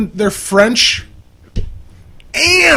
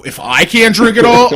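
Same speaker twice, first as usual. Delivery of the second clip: excited shout; 5 semitones higher, 5 dB louder than usual. Pretend. Excited outburst about beer.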